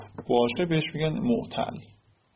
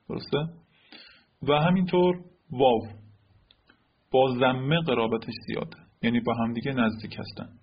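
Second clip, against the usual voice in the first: normal talking voice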